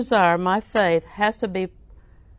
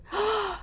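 sharp high excited gasp; 13 semitones higher, 7 dB quieter than usual. Retold or imitated. The lady gasping as she runs into the pastor. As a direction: gasp with delight. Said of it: "she said".

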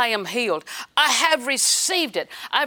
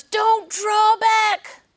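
much higher, louder, very slow; emphatic command; 10 semitones higher, 5 dB louder, much slower than usual. Acted. Preacher quoting Hebrews to the church, slow and loud. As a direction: stretch it out like a thundering warning. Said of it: "Hebrews says".

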